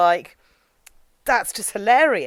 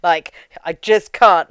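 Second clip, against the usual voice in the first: a louder, fed-up mock Chucky, throwing up his hands; exasperated Chucky; 3 semitones lower, 7 dB louder than usual. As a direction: sigh it out like a doll who has had enough of this guy. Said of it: "Chucky himself was like".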